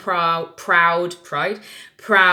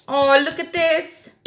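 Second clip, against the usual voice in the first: high, loud, drawn-out discovery; excited exclamation; 10 semitones higher, 5 dB louder, slower than usual. Real, not acted. Reader reacting to a new card, excited exclamation. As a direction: exclaim slowly in a raised voice.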